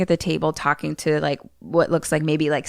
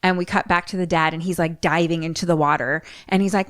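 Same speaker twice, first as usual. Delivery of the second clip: ordinary delivery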